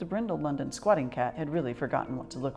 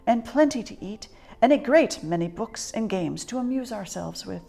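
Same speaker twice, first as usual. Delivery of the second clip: put-on old lady planning; 7 semitones higher, 4 dB louder than usual. A narrator's reading. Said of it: "said the old lady".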